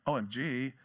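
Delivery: casual OMG. Shown as a demonstration. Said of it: "he doesn't say it like".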